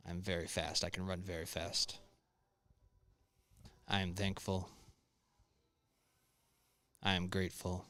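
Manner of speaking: soft, spaced-out affirmations; guided meditation. Acted. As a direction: recite calm mantras quietly, with long pauses. Doing a bit of a guided meditation.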